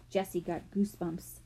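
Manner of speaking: ordinary delivery, nothing marked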